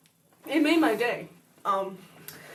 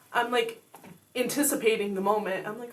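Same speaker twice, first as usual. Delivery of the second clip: usual speaking voice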